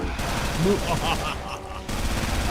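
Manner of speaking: villainous cackle, hammed up; evil laugh. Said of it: "I just thought to do an evil laugh there".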